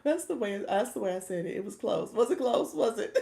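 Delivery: loud, high and tickled; amused protest. Real, not acted. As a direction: protest loudly, about to crack up. Cracking up over her attempt.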